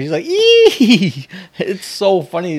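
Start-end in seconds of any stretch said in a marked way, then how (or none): 0.2-1.2 high, loud squeal of glee; imitated gleeful laugh. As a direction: mimic a little girl's evil giggle. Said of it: "starts dying laughing".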